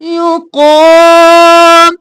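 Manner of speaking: युक़ाम with क़ा drawn long and heavy; युक़ाम recited slowly with long heavy क़ा; tajweed demo. Shown as a demonstration. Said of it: "जल्दी से क़ा को खींचेंगे".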